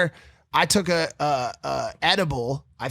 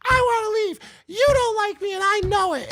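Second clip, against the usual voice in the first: much higher whiny imitation of a panicked girlfriend; high mock girlfriend. Boyfriend mimicking girlfriend trying to leave at night, high and whiny. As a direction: squeak it up high like a frantic girlfriend. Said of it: "She's like".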